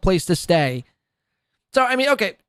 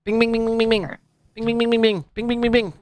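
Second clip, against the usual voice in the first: high repeated bings; chanted bings; 6 semitones higher, faster than usual. Acted. Mocking donation sounds with high chanted bings. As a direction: rattle off bings like a machine.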